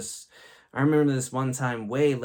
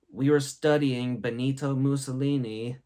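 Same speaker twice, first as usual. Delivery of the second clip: usual speaking voice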